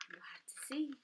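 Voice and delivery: secretive whisper. Real, not acted. Teacher whispering to the children to build suspense. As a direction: let them lean in for the answer.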